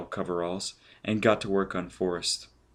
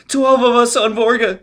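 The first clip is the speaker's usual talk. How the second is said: much higher, louder scream; choking man screaming out the answer; 10 dB louder than usual. Acted. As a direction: blurt it in terror. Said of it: "he screamed".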